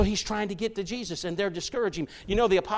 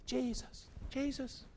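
hushed pleading; 3 semitones higher, 16 dB quieter than usual. Retold or imitated. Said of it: "He was probably going over there".

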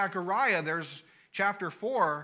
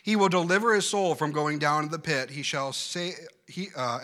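normal talking voice

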